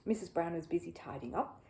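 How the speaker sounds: ordinary delivery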